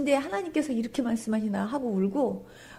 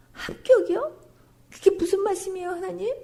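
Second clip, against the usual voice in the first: much higher, rising, tearful question; tearful disbelief; 8 semitones higher than usual. Retold or imitated. A weeping woman asks God what he means. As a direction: cry out the question in shock. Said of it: "울면서 제가".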